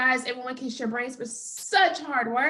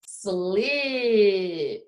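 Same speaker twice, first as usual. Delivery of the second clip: say it slowly; 3 semitones lower than usual. Shown as a demonstration. Teacher giving the last word for listening, slowly stretched. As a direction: slip stretched before a separate final P. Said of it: "I'm gonna say it slowly".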